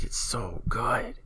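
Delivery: breathy, drawn-out whisper of delight; awed, stretched-out whisper; gushing whisper. Real, not acted. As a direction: whisper it slowly, savouring how good it is.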